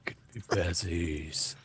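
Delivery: low, hushed, drawn-out menace; deep, soft creature voice; ominous eye creature. Acted. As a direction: voice an ancient watching thing, very softly. Game master voices the eye.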